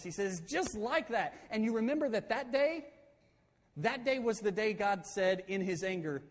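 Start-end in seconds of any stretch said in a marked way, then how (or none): 0.5-4.2 retold heated emphasis, higher pitch; fired up. Moses comparing tribes to the spies, fired up. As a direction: act out driving the point home. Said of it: "he gets really fired up".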